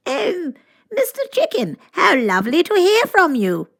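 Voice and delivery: high, loud regal lady voice; the Queen. A narrator's reading. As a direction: gush like a delighted queen. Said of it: "the Queen said".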